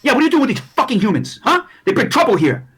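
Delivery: high, loud, fast accusation; agitated little gang member; hyped-up halfling. Acted. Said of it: "they're all fucking real hyped up".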